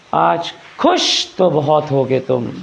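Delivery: slow theatrical आज खुश तो बहुत होगे तुम with stretched vowels; slow, drawn-out stage line; dramatic stage delivery. Shown as a demonstration. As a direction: deliver it like a film villain, slowly. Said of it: "नाटकों में कैसे कहते हैं".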